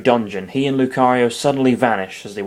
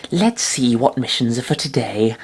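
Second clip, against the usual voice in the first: toned-down Chikorita voice. Acted. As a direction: voice her more gently, less put-on. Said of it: "That's less racist, probably".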